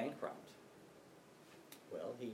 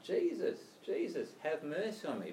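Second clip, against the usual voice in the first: higher-pitched plea, acted; crying out; 6 semitones higher than usual. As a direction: call out like a beggar pleading for mercy. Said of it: "And he's crying out".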